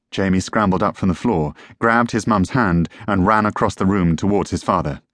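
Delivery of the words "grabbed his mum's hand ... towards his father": ordinary delivery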